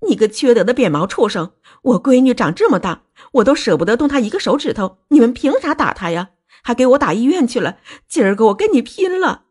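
high-pitched furious scolding; angry cursing. Acted. Parent clawing at daughter's attacker's father, cursing angrily. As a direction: curse them out, pitch riding high. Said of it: "他边挠边骂".

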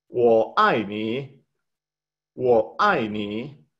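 Wo ai ni said slowly, each syllable spaced apart; slow Mandarin drill. Shown as a demonstration. Teacher slowly drilling Wo ai ni for the class. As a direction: spell out Wo ai ni syllable by syllable. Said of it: "Va de nuevo".

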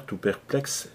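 plain reading voice

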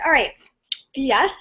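ordinary talk, nothing marked

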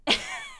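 high burst of laughter; loud, high cackling outburst; mocking laugh. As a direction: imitate a friend cracking up. Retells friend laughing in his face.

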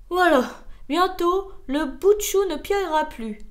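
proud, projected announcement. A narrator's reading. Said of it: "dit fièrement l'escargot".

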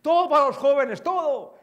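high, loud, enthusiastic mock rallying cry; gushing slogan. Speaker mocking what youth workers over forty used to proclaim, loud and high. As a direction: shout it like an eager youth worker. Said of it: "decíamos".

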